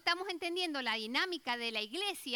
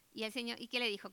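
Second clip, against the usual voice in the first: ordinary talk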